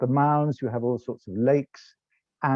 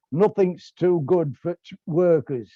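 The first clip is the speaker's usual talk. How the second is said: a hammed-up Yorkshire accent, a little higher and louder; proud working-class Yorkshire voice, half-hearted; Yorkshire accent attempt; 5 semitones higher, 4 dB louder than usual. The unionist at the Ritz answering the Tory, in a Yorkshire accent. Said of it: "that was an attempt at a Yorkshire accent".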